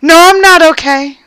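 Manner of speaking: high, tense mock indignation; retold indignant reply. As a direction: act out a firm, upset refusal. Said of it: "And I said".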